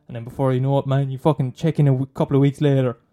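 ordinary talk, nothing marked

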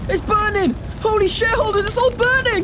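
high-pitched panic, rising; panicked alarm. Acted. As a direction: raise the alarm about a fire. Alarm as fire spreads.

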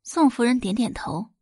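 ordinary delivery, nothing marked

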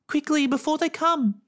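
high, loud hurried plea; urgent. A narrator's reading. Girl urging the spirit before parents come. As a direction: urge it before the grownups come.

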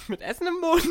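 laughing through the words; giggling high and loud. Real, not acted. Admits talking while eating.

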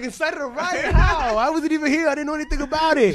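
high, laughing disbelief; incredulous. Retold or imitated. Teen accused of a riot, high incredulous protest. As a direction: protest your innocence in amazement.

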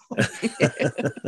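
high unvoiced laugh; a quick airy chuckle at his own story; real laugh, high and breathy. Real, not acted. Laughs after the punchline.

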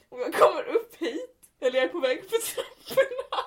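ordinary talk

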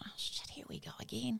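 breathy whisper of dread. Retold or imitated. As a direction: whisper it like a mother bracing for trouble. Dreading the detention talk.

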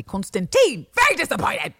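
outburst rising in pitch; hammed-up scolding yell; mock yelling. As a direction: burst out in exaggerated disappointment. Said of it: "keep yelling and grunting".

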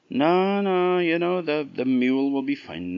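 high, quick, cheeky fool Jack. Acted. Jack refusing the fine mares, high and cheeky. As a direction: brush it off with a laugh in the voice.

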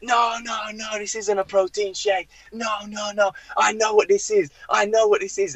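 high-pitched mock indignation; seething manager. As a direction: imitate an angry boss refusing every excuse. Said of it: "seething, ... breathing fire".

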